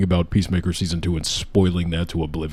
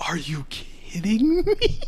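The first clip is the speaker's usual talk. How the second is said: whisper; 13 semitones higher, 6 dB quieter, slower than usual. Real, not acted. Disbelieving about announcement.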